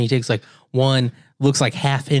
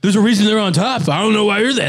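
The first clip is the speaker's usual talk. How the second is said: mock defender; 8 semitones higher than usual. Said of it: "people that are like".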